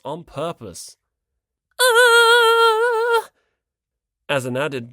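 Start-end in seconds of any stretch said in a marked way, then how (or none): 1.8-3.3 high loud wailing ah; long high loud ah; sung-out cry. Acted. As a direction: cry out as she tumbles. Tsumiki cries as she falls.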